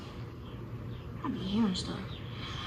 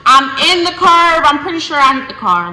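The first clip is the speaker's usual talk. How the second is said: excited retelling; 6 semitones higher, 9 dB louder than usual. Real, not acted. Retelling crash panic.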